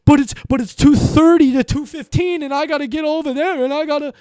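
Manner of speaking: panicking teammate pleading about the clock; panicked whining. Retold or imitated. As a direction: squeal it like a rushed teammate. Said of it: "There's certain times where you have to rush".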